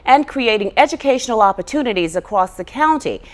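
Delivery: projected broadcast script read; announcer introduction. Acted. Host welcoming a school official to the show, in formal announcer style. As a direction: read it out as a programme host's intro.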